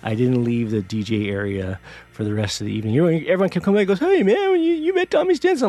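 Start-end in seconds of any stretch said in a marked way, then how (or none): 4.0-5.7 much higher, louder excited question; nosy clubgoer. Retold or imitated. Clubgoers ask [name] if he met Tommy, high and excited. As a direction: chirp it up like an eager fan. Everyone asking him at the club.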